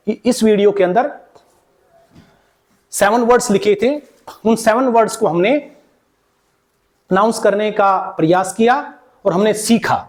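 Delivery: ordinary talk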